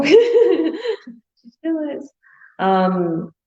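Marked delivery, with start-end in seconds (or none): 0.0-2.1 high, slow, laughing through the words. Real, not acted. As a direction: giggle out the repeat of the joke. Laughing repeat of joke.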